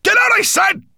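high, loud roar; furious roar. A narrator's reading. Nettlebrand driving the raven out, roaring. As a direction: roar at him to leave. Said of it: "Nettlebrand roared".

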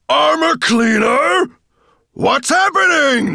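slow, very high, full-volume roar. A narrator's reading. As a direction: roar furiously for your servant. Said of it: "Nettlebrand roared".

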